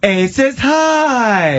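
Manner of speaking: female announcer impression. Mocking the show's opening announcer, slow high and drawn out. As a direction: stretch it out like a glitzy Vegas show intro. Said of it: "the female announcer doing that".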